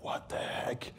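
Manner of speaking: soft unvoiced what the heck; whispered puzzlement. Soldier puzzled by a strange call on his phone, whispering.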